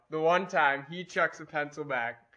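ordinary delivery

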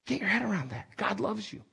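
soft, intimate preaching; hushed emphasis. Real, not acted. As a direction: drop the voice to let it sink in. Preacher repeats key point, hushed.

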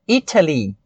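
British "Italy" with a crisp true T; British pronunciation. Shown as a demonstration. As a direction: sound the T fully in "Italy". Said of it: "Uma pronúncia mais britânica".

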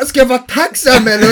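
high, laughing sarcastic question; sarcastic, laughing at the memory; laughing retort. Retold or imitated. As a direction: toss back the jab, cracking up.

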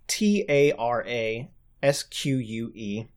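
T A R A S Q U E read letter by letter; spelling it out. Shown as a demonstration. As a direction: spell out "T A R A S Q U E" one letter at a time. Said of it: "That's spelled".

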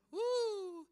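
high, loud preacher's "woo"; exultant whoop. Real, not acted. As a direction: let out a sudden high whoop. Preacher's exultant whoop mid-sermon.

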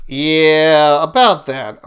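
drawn-out, loud "yeah" of doubt; hedging reply. Acted. Satan answers God about his servant Job, hedging. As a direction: stall on the yeah, as if objecting.

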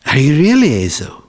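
quick, loud, high-peaking question; doubting father. Acted. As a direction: press him fast, pitch swooping up then down. Father asks again, doubting.

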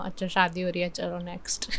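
soft, unmoved mock dismissal; sociopath-like indifference. Showing her friend how weddings leave her cold, indifferent. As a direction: toss it off quietly, acting totally unmoved. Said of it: "I'm like a sociopath now".

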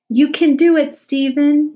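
loud, high cheer of encouragement; rally him on with a cheer; a classmate cheering Stephen on. A narrator's reading. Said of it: "cheered Kathy".

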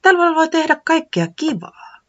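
high bright character voice; high cheerful weatherman. Acted. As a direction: sing out the joy of winter.

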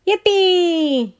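put-on high, loud child cheer; yelled yippee. A narrator's reading. Billy cheering the plane into the sky, yelling. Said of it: "yelled Billy".

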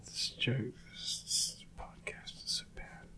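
hushed, breathy whisper. Real, not acted. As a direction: whisper it under your breath. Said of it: "why are you whispering?".

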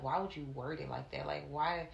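low, hushed questioning, imitating a gentle man; soft reproach. Retelling his reaction to harsh wording, low and soft. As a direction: quote him softly, gently questioning. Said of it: "He was like".

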